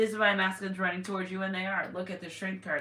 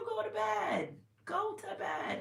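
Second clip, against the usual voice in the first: sing-song much higher, go to bed; teasing scold; 14 semitones higher, 4 dB quieter, slower than usual. Real, not acted. To the sleepless karmic, a high teasing scold. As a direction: tease her like a big sister.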